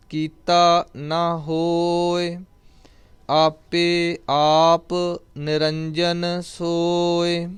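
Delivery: slow, drawn-out devotional chanting of each line; scripture recitation. Acted. Teacher reciting Gurbani for students, slow devotional chant. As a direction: intone the verse slowly and reverently.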